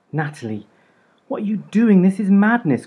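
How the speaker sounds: high, loud alarmed cry; cried out. A narrator's reading. Mum crying out as her daughter burns the wedding dress, high and loud. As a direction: cry out in shock at the burning dress. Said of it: "cried my mum".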